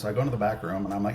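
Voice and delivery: normal talking voice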